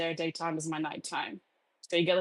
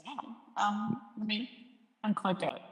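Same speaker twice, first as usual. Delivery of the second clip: down a well; 4 dB quieter than usual. Real, not acted. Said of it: "Sounds like you're at the bottom of a well with no water".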